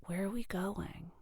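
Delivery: hushed, whispered question. Retold or imitated. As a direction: whisper it like a child just waking in the car. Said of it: "I whispered".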